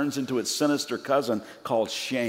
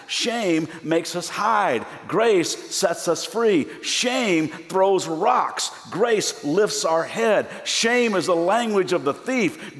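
raised, emphatic sermon voice with deliberate pauses; impassioned preaching; 5 semitones higher, 5 dB louder than usual. Real, not acted. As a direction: preach each contrast with mounting force, stretching key words. Preaches shame against grace.

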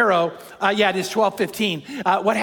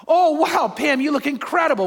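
amazed admiration; 6 semitones higher, slower than usual. Retold or imitated. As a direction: re-enact being bowled over, voice lifted. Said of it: "and I went".

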